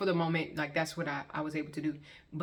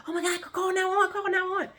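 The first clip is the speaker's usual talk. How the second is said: high, loud frantic cry for help; panicked cry; 5 dB louder than usual. Retold or imitated. Family at the cookout panicking as Max collapses, frantic high cry. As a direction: shriek for someone to call 911.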